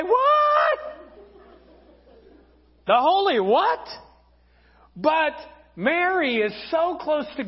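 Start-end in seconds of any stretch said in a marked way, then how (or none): 0.0-3.9 shocked woman. Acted. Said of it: "most women at this time would say something like this".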